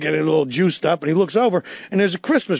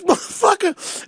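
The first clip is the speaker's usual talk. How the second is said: much higher, slow pained cry; pained groan; 12 semitones higher, slower than usual. Retold or imitated. Beaten suspect groaning at the bunny, higher and slow. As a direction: groan it out after the beating. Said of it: "He goes".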